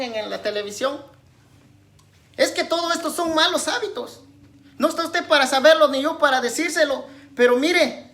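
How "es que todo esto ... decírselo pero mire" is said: louder, higher, emphatic preaching; prédica enérgica. Real, not acted. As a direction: preach it forcefully to the congregation. Predica con fuerza. (predicando con fuerza)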